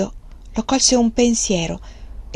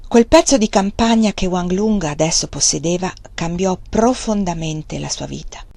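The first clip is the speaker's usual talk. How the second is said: plain reading voice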